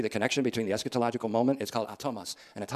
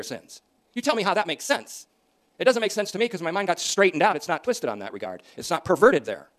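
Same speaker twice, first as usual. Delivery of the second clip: louder, driving pulpit delivery; fiery preaching; 4 semitones higher, 7 dB louder than usual. Acted. Preacher rebuking the church on hell, fiery preaching. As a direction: preach it hard to the congregation.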